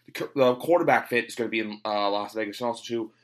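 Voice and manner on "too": ordinary delivery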